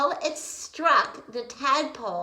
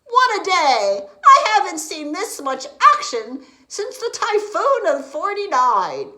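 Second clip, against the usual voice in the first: jolly captain; 8 semitones higher, 11 dB louder than usual. Acted. Jolly captain after rescue.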